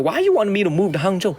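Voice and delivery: hammed-up baffled question; replaying his own reply to the assistant; retelling his own question. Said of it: "I was like".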